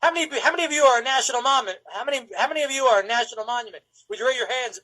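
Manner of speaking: imitated radio DJ, high and quick. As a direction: rattle it off like a DJ working a crowd. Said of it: "talking like a radio D J".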